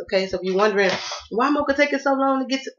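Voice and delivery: usual speaking voice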